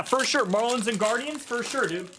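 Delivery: normal talking voice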